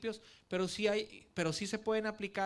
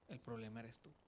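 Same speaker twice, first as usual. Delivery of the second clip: even softer, lower, almost hushed; tender; 5 semitones lower, 11 dB quieter than usual. Retold or imitated. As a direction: say it with loving pain. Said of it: "con mucho amor Dios me dijo". (casi susurrado, con amor y dolor)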